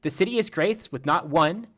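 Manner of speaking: TikTok robot lady. Retold or imitated. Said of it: "that TikTok lady's voice".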